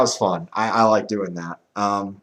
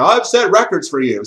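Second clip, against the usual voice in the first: raised, mildly mock yell; mock scream; 6 semitones higher, 6 dB louder than usual. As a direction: imitate someone screaming down the phone. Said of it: "probably screaming at him".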